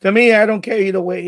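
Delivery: normal talking voice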